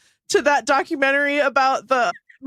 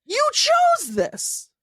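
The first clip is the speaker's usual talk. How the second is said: higher, slow mock scolding; 3 semitones higher, much slower than usual. Co-host scolding Nancy over the rug, slow mock scold. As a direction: drag out the accusation playfully.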